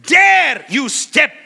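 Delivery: loud, slow commanding shout; stretched-out shouted challenge; shouted rebuke. Retold or imitated. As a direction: drive the devil back with your voice. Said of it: "I said".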